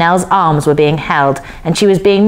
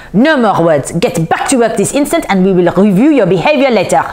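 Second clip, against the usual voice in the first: irate little man's put-on voice; theatrical, bossy telling-off; mild shout; 4 semitones higher than usual. A narrator's reading. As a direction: order them back to work crossly. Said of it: "shouted the little man".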